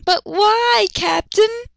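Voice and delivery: put-on high, pleading girl voice; whiny Rangiku. Rangiku whining to her captain, high pleading voice. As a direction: beg like a spoiled lieutenant.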